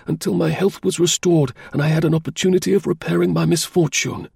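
high-pitched voice of an anxious invalid client; eager client. A narrator's reading. Client telling Holmes of fresh life and a letter, high eager voice. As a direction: pour out news, high and grateful.

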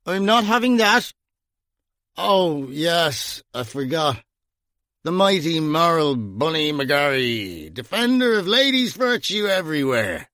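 high, sneering, mock-grand; mock him as a saint; drunken mockery. A narrator's reading. Slapped Gringo mocking Bunny's morals, sneering.

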